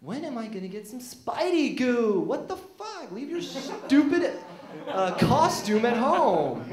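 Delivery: high, loud exasperated whine; Mary Jane fed up with Spidey; frustrated Mary Jane. Retold or imitated. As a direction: complain like a neglected girlfriend. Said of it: "just wondering".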